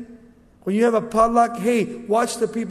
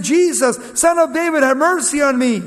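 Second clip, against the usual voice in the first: loud, high plea called out; crying out; 8 semitones higher, 8 dB louder than usual. Acted. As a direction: call out for mercy at raised pitch and volume. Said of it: "he began to cry out".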